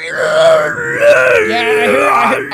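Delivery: very high, loud screeching shout; high screechy yell. Retold or imitated. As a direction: screech it like a scream. Imitating scream while talking.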